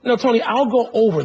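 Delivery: normal talking voice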